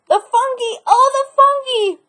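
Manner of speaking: suddenly remember dinner with glee; boy's excited exclamation. Acted.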